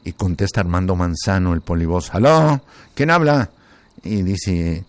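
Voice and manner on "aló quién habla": loud, high phone greeting; loud phone answer. Retold or imitated. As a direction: bark it into the receiver. Imitating Armando answering phone.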